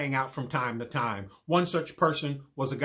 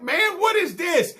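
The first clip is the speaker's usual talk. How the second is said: shocked outburst; 8 dB louder than usual. Retold or imitated. Startled outburst to friends.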